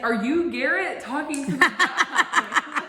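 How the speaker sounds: laughing quote. Retold or imitated. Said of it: "and she's like".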